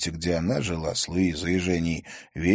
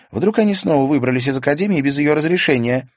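put-on higher young girl's voice, rueful; girl Karina voiced; 8 semitones higher, 8 dB louder than usual. A narrator's reading. Karina admits her foolishness to Stas, higher girl's voice. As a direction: play Karina, higher and lighter, sheepish.

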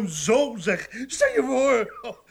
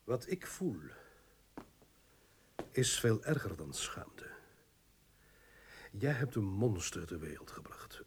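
much softer, whispered contempt; bitter hush; 10 semitones lower, 10 dB quieter than usual. Acted. Husband telling his wife about their son, bitter whisper. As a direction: hiss the disgust low.

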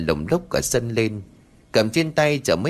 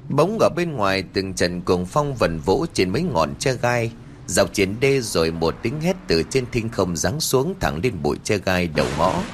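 plain reading voice

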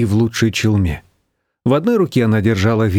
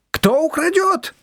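nearly screaming; slower than usual. A narrator's reading. Shushana insisting nobody will steal the handbag, nearly screaming. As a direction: squeal it in exasperation. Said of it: "чуть не кричала Шушана".

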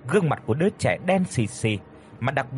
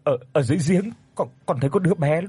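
frightened telling; 5 semitones higher, faster than usual. A narrator's reading. Daughter tells father, still scared.

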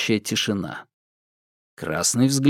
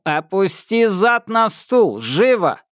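metallic patrolman; 8 semitones higher, slower than usual. Acted. Patrolman orders Thomas.

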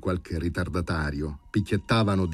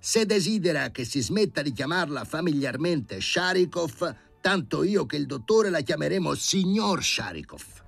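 raised, higher lecturing voice; indignant professor; 10 semitones higher than usual. Acted. The professor scolding Sharikov, higher and stern. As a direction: lay down the law in his own home. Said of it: "tuonò dalla soglia Filippo Filippovic".

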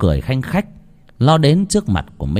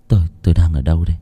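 dazed hurried question; 5 semitones lower, much faster than usual. Acted. Dazed man wakes confused.

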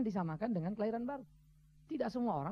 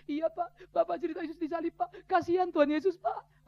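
imitated weepy child, pitched up; mock tearful; 5 semitones higher, 4 dB louder than usual. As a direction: plead tearfully, as a put-on child. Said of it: "Kenapa nangis?".

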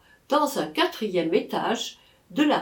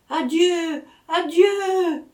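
high, louder call: Adieu, adieu!; cried out; 9 semitones higher, 5 dB louder, much slower than usual. Acted. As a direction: shout Adieu after the departing carriage. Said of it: "lui cria encore mademoiselle Charlotte". (crié fort et aigu : Adieu, adieu !)

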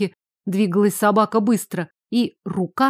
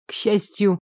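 ordinary delivery

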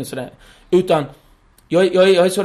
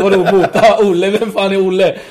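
louder, baffled dad laughing through it; confused dad; 3 semitones higher, 6 dB louder than usual. Retold or imitated. Dad to the cops, louder and baffled. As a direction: demand who Olle is. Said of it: "farsan fattar inte".

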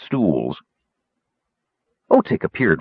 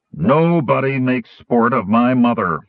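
slow, indignant dwarf voice; outraged declaration; 4 semitones higher than usual. A narrator's reading. Insulted dwarf to the kender who mocked his mother, outraged. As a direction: declare it in offended outrage, drawn out. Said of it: "The dwarf bellowed his outrage".

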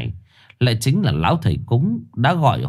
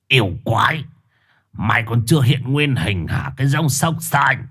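higher accusing snarl at the demon; 4 semitones higher than usual. Acted. Shaman denouncing the dog to the family, accusing. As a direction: point and denounce the creature.

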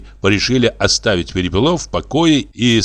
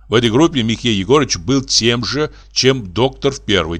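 plain reading voice

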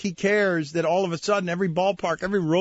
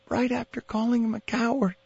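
normal talking voice

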